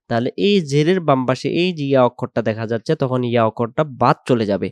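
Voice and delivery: normal talking voice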